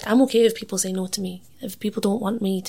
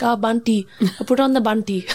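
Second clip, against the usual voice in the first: put-on Punjabi-Indian accent; 3 dB louder than usual. Showing how the band name sounds at home, Indian accent. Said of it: "if you had a Punjabi or Indian accent".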